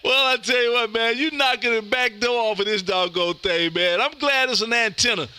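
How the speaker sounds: usual speaking voice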